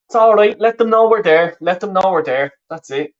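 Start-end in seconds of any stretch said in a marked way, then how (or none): 0.1-1.6 higher, louder call to the team; urging the players on from the stands. Real, not acted. Cheering on Ireland during the match.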